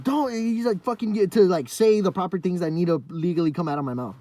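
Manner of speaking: high, loud mock principal rant; yelling principal. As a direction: bark it like the fuming principal. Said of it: "he's just yelling at me".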